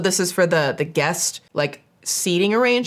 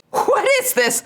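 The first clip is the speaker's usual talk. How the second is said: high-pitched Southern exclamation of disbelief; Southern accent; 13 semitones higher than usual. Acted. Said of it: "I had to say that in a Southern accent this time".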